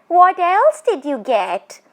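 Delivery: high, eerie teasing spirit voice; a tall witch toying with her prey; ghost woman. Acted. Ghost woman teasing the frightened Bishan Singh, eerie and high.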